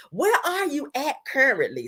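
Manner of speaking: high, whiny imitated question; mock desperate. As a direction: beg to know, hammed-up. Repeats his desperate text mockingly.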